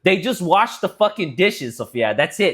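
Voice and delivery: high, loud mocking lecture; exasperated. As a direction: lecture her, fed up. Retold reply, heated.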